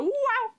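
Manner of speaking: short, high, contained "wow"; toned-down launch whoop. Shown as a demonstration. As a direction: whoop high but hold back the volume. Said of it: "don't do that one as loud anymore".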